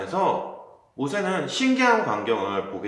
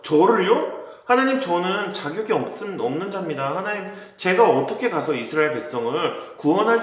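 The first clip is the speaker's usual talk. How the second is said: anxious protest; 6 semitones higher than usual. Acted. Moses nervously protesting to God, higher and pleading. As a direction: plead that you are not worthy.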